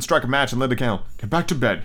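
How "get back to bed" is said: plain reading voice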